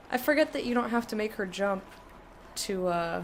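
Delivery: ordinary delivery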